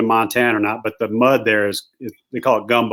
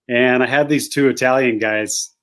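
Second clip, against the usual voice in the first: usual speaking voice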